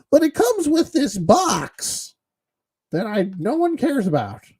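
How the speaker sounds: mocking, squeaky-high fake enthusiasm; mock hype. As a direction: sarcastically gush way up high in a put-on voice. Mockingly hyping a box nobody wants.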